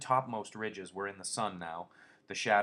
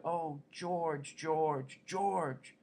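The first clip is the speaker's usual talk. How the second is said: crying out; 7 semitones higher, much slower than usual. A narrator's reading. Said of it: "And he cried".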